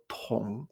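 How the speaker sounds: tong dropped low and soft; third tone on tong. Shown as a demonstration. Teacher isolates a syllable, tong in low third tone.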